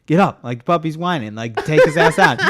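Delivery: loud, high insistent "get up"; husband insisting loudly at night; retold demand. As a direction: push her out of bed. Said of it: "And I was like".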